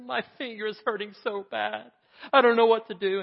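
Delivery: put-on high, loud child voice in pain; hurting child. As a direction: cry it out like a hurt little boy. Boy hurt his finger, calls.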